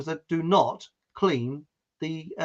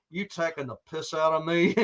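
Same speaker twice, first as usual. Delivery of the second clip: bad American accent; 4 dB quieter than usual. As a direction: challenge them as a badly faked Texan. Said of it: "I can't do an American accent".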